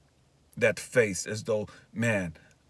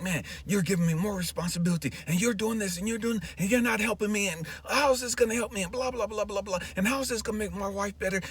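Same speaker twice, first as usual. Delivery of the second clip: high, fast, piled-up complaint; frustrated, racing retold rant; mad rant at God; 10 semitones higher, faster than usual. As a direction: rattle off grievances at God, pitched way up. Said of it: "I'm just going in on God, just mad, just mad".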